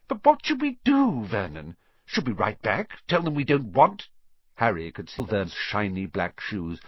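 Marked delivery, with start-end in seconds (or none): 0.1-4.1 worried aunt voice. A narrator's reading. Petunia worried.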